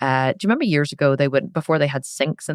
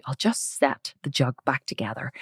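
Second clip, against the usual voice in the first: whispered plan to herself; scheming whisper; 3 semitones lower, 7 dB quieter than usual. Retold or imitated. Girl whispering her plan to hide the crack. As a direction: whisper the plan so no one hears. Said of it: "I thought".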